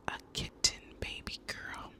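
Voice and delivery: ordinary delivery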